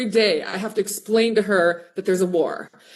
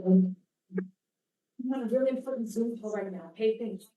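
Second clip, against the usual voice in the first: hushed aside; 9 dB quieter than usual. Real, not acted. Aside to someone at the door.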